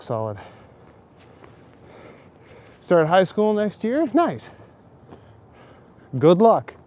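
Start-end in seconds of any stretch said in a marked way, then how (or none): 2.8-6.7 high, bright-eyed congratulations, much higher; excited. Real, not acted. Reacting to a viewer's message.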